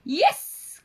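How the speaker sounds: high, loud exclamation; triumphant storybook cheer; witch crying out. A narrator's reading. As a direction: cry it out with delight. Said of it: "cried the witch".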